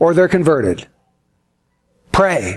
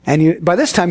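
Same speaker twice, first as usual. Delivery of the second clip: ordinary talk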